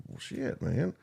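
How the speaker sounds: breathy under-the-breath curse; whispered aside. Retold or imitated. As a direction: mutter it to yourself. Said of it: "and I was like".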